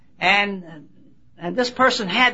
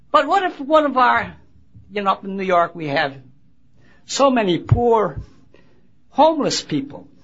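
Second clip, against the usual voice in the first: ordinary delivery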